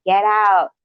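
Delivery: half-shouted acted command; mock yell. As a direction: imitate someone ordering intruders out. Retold man ordering intruders out.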